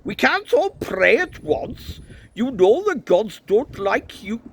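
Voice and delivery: high, loud Welsh priest; shrill-pitched, loud Welsh complaint; Welsh accent. A narrator's reading. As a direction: object indignantly in a Welsh lilt. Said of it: "trying to do a Welsh accent".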